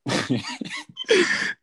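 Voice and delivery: real high-pitched laugh. Real, not acted. Telling friend about the old coworker, high laugh. Laughing while starting the anecdote.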